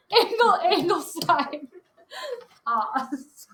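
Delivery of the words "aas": "Os" spoken like a word, giggling; imitating the acronym through laughter. Teacher repeats a student's acronym, laughing.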